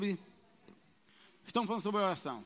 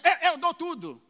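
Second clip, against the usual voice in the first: mock boastful churchgoer, higher and louder; showoff boasting; 8 semitones higher, 8 dB louder than usual. Churchgoer showing off to the congregation, loud boastful offer. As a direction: show off your generosity. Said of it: "Aí um queria aparecer".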